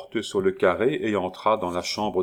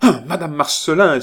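loud, high theatrical protest; grand outcry; 8 semitones higher, 9 dB louder than usual. A narrator's reading. Young man cutting short his mocking neighbour, grand outcry. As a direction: cry out in magnificent indignation. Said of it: "s'écria Roland avec un geste magnifique".

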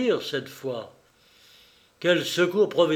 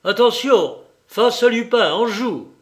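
loud, high command to fire; barked firing command; shouted order; 7 semitones higher, 9 dB louder than usual. Acted. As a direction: bark the order at his men. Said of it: "Il hurla".

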